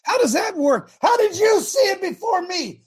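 very high-pitched protesting holler; yelling at wife. Real, not acted. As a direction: shout an indignant question at your wife. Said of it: "I yell at my wife".

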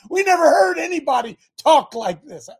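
high, loud, amazed outcry; astonished shout. Retold or imitated. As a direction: shout it like a stunned Pharisee. Pharisees quoted in astonishment.

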